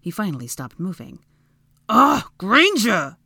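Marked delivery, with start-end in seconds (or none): 1.9-3.2 slow, loud, pained groan; ground out. A narrator's reading. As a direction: grind it out, pitched up. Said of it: "he ground out".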